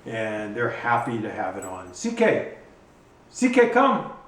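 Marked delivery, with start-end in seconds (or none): 1.9-4.1 high, loud call across the yard; calling the dog. Real, not acted. Owner calling his dog CK from across the yard, high and loud.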